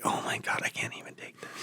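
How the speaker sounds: breathy whisper of disbelief; whispered exasperation. Retold or imitated. Cringing at his own videos, whispering in mock despair. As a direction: whisper it like you can't bear to watch.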